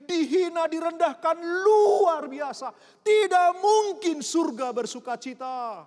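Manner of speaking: loud, high, impassioned preaching to the church; full-force preaching. Acted. Preacher pleading with the congregation, loud impassioned preaching. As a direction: pour it out like a pulpit climax.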